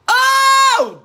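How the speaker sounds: high, loud "Oh" of delight; delighted exclaim. Real, not acted. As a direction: cry out, thrilled by a comment. Reacting to a viewer's comment.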